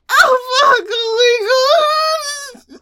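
high, long drawn-out cry; ecstatic moan. Acted. Wife overcome on the phone, moaning high. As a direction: wail it high and slow.

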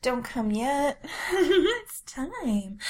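ordinary talk, nothing marked

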